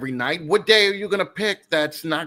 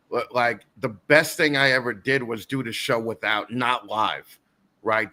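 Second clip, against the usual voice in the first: normal talking voice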